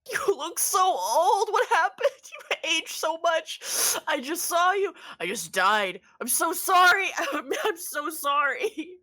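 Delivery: high, loud, emotional wail; hammed-up tearful reunion, near sobbing; overcome father. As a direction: pour out shock and apology in a high voice. Father hugs his aged son.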